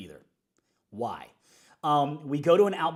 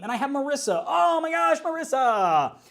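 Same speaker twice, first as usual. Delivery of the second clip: higher, louder, delighted; excited shout-out; 10 semitones higher, 7 dB louder than usual. Real, not acted. Leader thrilled to find Marissa on the team, excited. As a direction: gush over her name.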